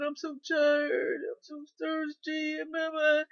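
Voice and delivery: high, slow, whiny complaint; mock moaning.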